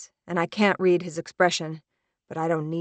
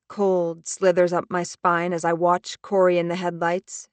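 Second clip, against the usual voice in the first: usual speaking voice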